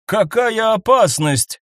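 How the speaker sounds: slow, loud angry question; angry demand. A narrator's reading. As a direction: demand it in anger. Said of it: "гневно спросила женщина".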